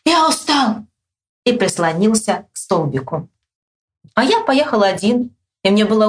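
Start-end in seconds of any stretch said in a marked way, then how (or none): 0.1-0.9 higher-pitched tired shout; mildly raised, high cry; calling out. A narrator's reading. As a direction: call it out to a friend. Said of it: "он крикнул".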